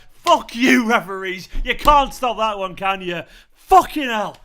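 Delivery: loud, high triumphant yelling; celebratory shout. Real, not acted. Fan yelling at referees after scoring, triumphant roar. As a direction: roar it at the ref.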